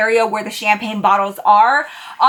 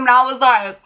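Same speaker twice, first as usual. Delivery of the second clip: usual speaking voice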